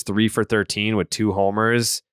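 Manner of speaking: normal talking voice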